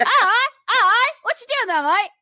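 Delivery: high, cartoonish Aussie accent; mock Australian accent. Riffing with co-host on the GPS voice, high hammed-up Australian. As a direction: ham up an Aussie, voice pushed way up. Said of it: "talking in Australian accents nonstop".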